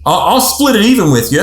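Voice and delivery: a hint of a guy haggling over chores; mildly voiced husband laying out his deal; bargaining guy. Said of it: "that guy says".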